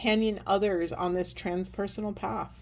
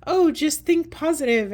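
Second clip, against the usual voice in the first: higher, louder sing-song mockery of empty positivity; mocking cheerful quote; 8 semitones higher, 6 dB louder than usual. Mocking social media gurus who push affirmations, higher, louder sing-song. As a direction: parody the preachy affirmation crowd. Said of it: "everyone's shoving affirmations down your throat".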